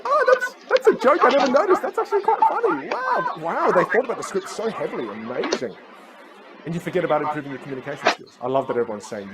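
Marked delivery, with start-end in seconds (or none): 0.0-5.7 squealing very high, delighted at a hidden joke; mock amazed fan. To the class, imitating a Game of Thrones rewatcher, high-pitched excited gushing. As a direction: gush like a fan discovering something new. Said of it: "You go".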